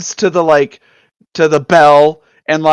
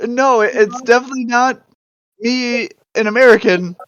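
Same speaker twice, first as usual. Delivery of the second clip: higher, defensive fake Canadian voice; bluffing Canadian guard accent; 8 semitones higher, 3 dB louder than usual. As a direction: insist in a put-on Canadian accent. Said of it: "I have a Canadian accent".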